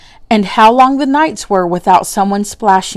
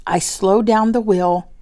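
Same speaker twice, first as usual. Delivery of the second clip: ordinary delivery, nothing marked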